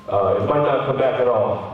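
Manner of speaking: low doctor voice; retold doctor. Doctor giving patient the worst outcome, low voice. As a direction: drop low to break bad news.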